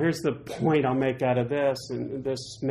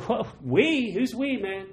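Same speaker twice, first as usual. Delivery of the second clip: higher, incredulous; mock disbelief; 8 semitones higher than usual. Pastor questioning Moses's "we", higher mock disbelief. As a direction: raise an eyebrow at it. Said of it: "I'd be like".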